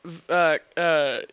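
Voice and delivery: ordinary talk, nothing marked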